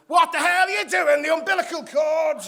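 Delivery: high loud shriek through laughs; mock shrieking wife. Wife yells at him.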